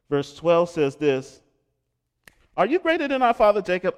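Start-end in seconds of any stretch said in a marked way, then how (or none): 2.6-3.9 sharp, fast, louder retort, pitched higher; snapped. Acted. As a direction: snap the line back fast and loud. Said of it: "I don't know why I snapped".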